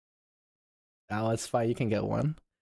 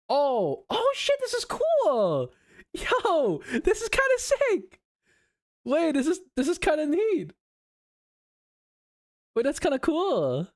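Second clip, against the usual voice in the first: excited; 11 semitones higher than usual. Real, not acted. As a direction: gush over the cool new pose. Excited about new 3D pose.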